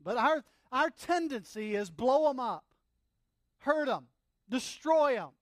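loud, high, emphatic sermon delivery; full-force preaching. Acted. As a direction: drive each phrase home at full voice.

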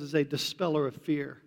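ordinary delivery

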